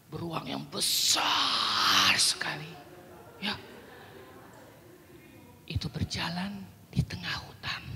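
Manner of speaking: low hushed whisper, slow, for a big bear; storytelling whisper. Shown as a demonstration. Teacher whispering the bear story to children. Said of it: "Suara tadi saya sudah tunjukkan contohnya".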